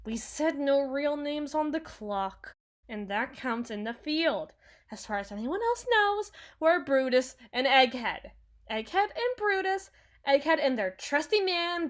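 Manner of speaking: high, loud, emphatic cover-up; defensive, sing-out correction; flustered insistence. Acted. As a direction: insist on the code names, pitch jumping up. Covers slip, insists on code names.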